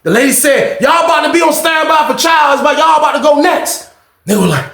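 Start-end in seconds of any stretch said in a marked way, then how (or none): 0.8-3.9 high, loud announcement to the unit; mock guard shouting to the inmates; officer calling out. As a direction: call it out across the block. Lady officer calling out orders.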